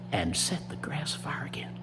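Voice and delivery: half-whispered, rhythmic story narration; hushed storytelling. A narrator's reading. Storyteller describing Rabbit secretly setting fires, hushed and rhythmic. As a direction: keep it soft and chant the repeats steadily. Tells the fire spreading.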